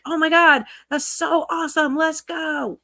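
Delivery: mock excitement. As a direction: ham up a gushing fan. Doesn't sound like he's pushing.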